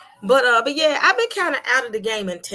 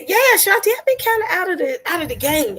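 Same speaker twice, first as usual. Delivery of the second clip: high, loud, bright hello to a viewer; light up as you greet her; greeting Shanti; 10 semitones higher, 6 dB louder than usual. Real, not acted.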